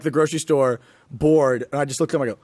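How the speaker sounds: usual speaking voice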